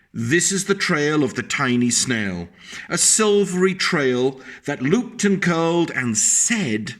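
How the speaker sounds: put-on lilting read-aloud voice for a child; sing-song picture-book reading; storybook narration. A narrator's reading.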